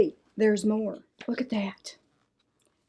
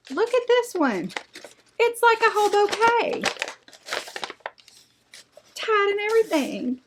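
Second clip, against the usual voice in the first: gushing delight; 10 semitones higher than usual. Real, not acted. Gushing over a flower bouquet.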